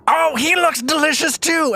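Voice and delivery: high, excited monster voice; hungry door creature. Acted. Door waddling towards Vadim, high hungry creature voice. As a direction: squeal with greedy delight as a monster.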